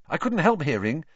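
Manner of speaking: hasty high-pitched excuse; added quickly. A narrator's reading. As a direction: slip in a quick apology. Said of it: "Harry added quickly".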